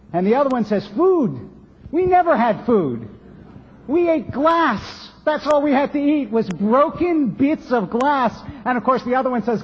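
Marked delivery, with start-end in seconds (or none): 0.9-8.4 mock indignant boast. Python man topping the boast.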